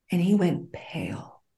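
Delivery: breathy, unvoiced telling; whispered suspense. Real, not acted. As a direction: whisper it like a scary story. Tense retelling, whispered.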